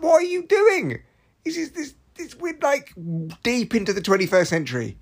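high-pitched mock exasperation; exasperated. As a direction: scold a baffling pensioner in disbelief. Acted outburst at a texting pensioner.

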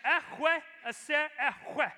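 "Echwe, aser echwe" slow, with a guttural ch; Hebrew phrase. Retold or imitated. God's name given to Moses, spoken slowly in Hebrew. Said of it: "he says".

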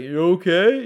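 a hint of a startled dad; lightly voiced puzzled dad. Dad to his screaming son, mildly alarmed.

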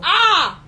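a very loud, high cry of disbelief; loud shout of disbelief. Real, not acted. Loud shout of disbelief at the manager joke.

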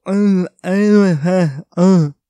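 loud strained grunts from a swollen throat; struggling to speak. Retold or imitated. Showing how he couldn't talk, loud strained grunts. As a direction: push out sound through a blocked throat. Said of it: "eu ficava tipo".

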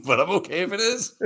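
high, laughing admission; laughing through words. Real, not acted. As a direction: say it while cracking up. Laughing after own joke.

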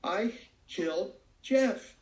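hysterical confession. Retold or imitated. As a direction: choke out the words between sobs. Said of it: "Pam was crying hysterically".

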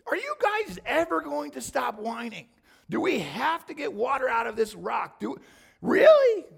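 exasperated, climbing into a yell; exasperated rant. Retold or imitated. Moses fed up with the whining people, shouting. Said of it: "He's like".

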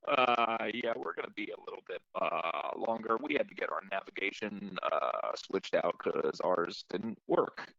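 unbothered, halting cockpit announcement; nonchalant airline captain. Retold or imitated. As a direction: imitate a captain shrugging off trouble over the intercom. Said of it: "he goes".

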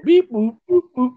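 high, loud electronic beep-boops; imitating robot beeps. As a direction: mimic a garbled robotic signal. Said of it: "robot voice not coming across".

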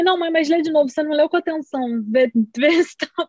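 acting out an anxious plea to mother; mock pleading. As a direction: press her to reread, playfully exasperated. Retells pestering mother to reread.

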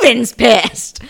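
slow, high squeal through laughter; excited shriek. Real, not acted. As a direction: draw it out, loud, cracking up. Delighted shriek answering co-host's question.

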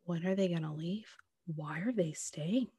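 whispered anxious quote; imitating a fretful new mom in a whisper. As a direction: hush it like guests might hear. Quoting friend's impatience with guests.

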